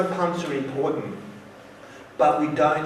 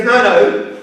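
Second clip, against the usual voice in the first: insistent no; 5 semitones higher, 9 dB louder than usual. Retold or imitated. Director insists, correcting student.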